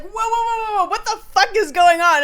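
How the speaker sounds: alarmed shout. Retold or imitated. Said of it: "And she's like".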